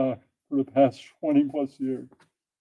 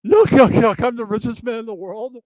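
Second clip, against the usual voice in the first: silly Elon Musk voice; 8 semitones higher than usual. Acted. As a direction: play a cartoonish billionaire chuckling at his own joke. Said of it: "he's not gonna say it like that".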